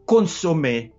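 ordinary talk, nothing marked

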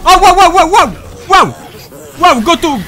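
high, loud repeated wows; breathless loud wows; stunned wows. Real, not acted. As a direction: gasp at each attack. Dodging boss attacks.

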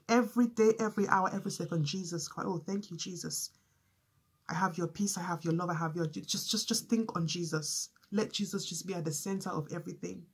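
hushed prayer. Real, not acted. Quiet prayerful meditation on Jesus.

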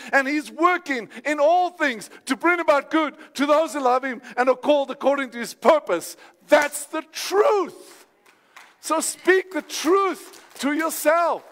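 high, ringing declarations of faith; full-force preaching. Real, not acted. Preacher urging congregation to preach to themselves, high impassioned exhortation.

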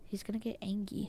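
hushed warning whisper. Real, not acted. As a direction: whisper so he can't hear.

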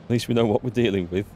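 ordinary talk

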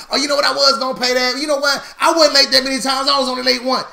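high-pitched, babbling self-justification; rambling excuse-maker. Acted. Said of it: "when you get to talking and rambling".

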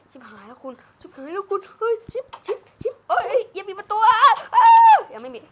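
frantic high screaming panic; panicked yelling. Real, not acted. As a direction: yell in real panic as the door opens. Panic as zombies break in.

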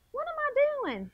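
ordinary talk, nothing marked

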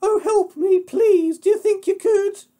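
very high, loud pleading squeal; pig crying for help. Acted. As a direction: beg in a panicky piggy falsetto. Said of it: "Pig cried".

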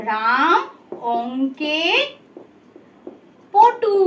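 word by word with long pauses; dictation pace. Shown as a demonstration. Teacher writes a second sentence, word by word. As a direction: stop after each word, as if writing it. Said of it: "ধরো আমি একটা বাক্য লিখছি".

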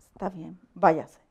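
low, near-whispered imitation of an officer; quiet dismissal. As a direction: murmur it as the cop letting her go. Retold officer lets her go. (despedida imitada, casi susurrada)

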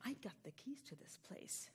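very soft, voiceless stage whisper; conspiratorial whisper. Shown as a demonstration. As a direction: whisper it like a playful secret. Partner echoes the improv line.

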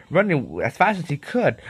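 ordinary delivery

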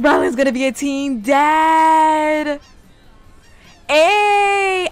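loud, stretched-out announcement; thrilled disbelief. Real, not acted. Player realising Rylan will be a father, thrilled. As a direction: draw out the big news, then cheer.

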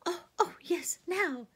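high loud squeal of joy; excited girl. A narrator's reading. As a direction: bounce with delight. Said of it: "said Lucy, jumping up and down".

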